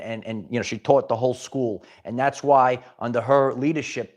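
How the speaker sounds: ordinary talk, nothing marked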